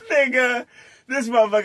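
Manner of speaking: ordinary talk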